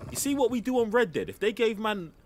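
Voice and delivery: high and loud, getting carried away; excited rant. Real, not acted. Streamer ranting to chat about Red Dead, excited and loud. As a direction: burst out with excitement.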